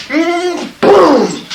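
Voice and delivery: high wind-up into a loud boom; kick impact. Retold or imitated. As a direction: wind up, then explode on the hit. Imitated kick to the balls.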